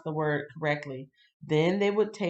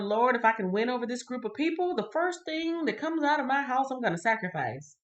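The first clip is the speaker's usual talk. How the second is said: higher-pitched quoted promise; vow to God; 7 semitones higher than usual. Acted. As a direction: pledge it to the Lord, pitch lifted. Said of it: "he made a promise to the Lord".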